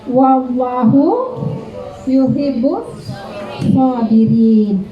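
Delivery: very slow, word-by-word Arabic recitation. Acted. Leading teachers in reciting the verse together, very slowly. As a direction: draw out each word of the verse.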